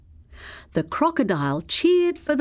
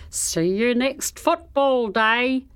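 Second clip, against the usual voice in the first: called out; 4 semitones higher than usual. A narrator's reading. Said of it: "the crocodile called".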